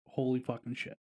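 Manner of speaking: ordinary talk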